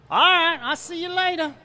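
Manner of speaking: sing-song see-you-later; Thibodeau goodbye. Acted. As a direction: toss it off cheerfully. Thibodeau's cheery goodbye in joke.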